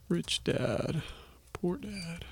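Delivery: softly muttered while typing a search; quiet murmur. Real, not acted. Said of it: "let's go ahead and look the guy up".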